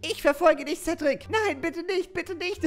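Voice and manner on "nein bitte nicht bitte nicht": high, laughing mock pleading; play-scared while giggling; mock panic. Cedric chased on the field, laughing mock panic.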